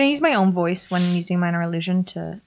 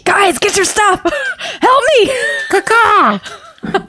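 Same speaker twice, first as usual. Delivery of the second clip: laughing plea; 13 semitones higher, 4 dB louder, much slower than usual. Real, not acted. Breaking character, laughing plea.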